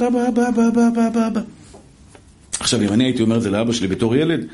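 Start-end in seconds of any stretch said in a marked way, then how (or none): 0.0-1.4 put-on high, insistent repeated calls of אבא, like nagging children; mock kids calling dad. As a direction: chant אבא over and over in a child's nag. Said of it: "והן זורקות לי הוראות".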